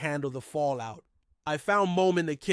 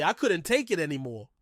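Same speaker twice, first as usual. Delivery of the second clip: usual speaking voice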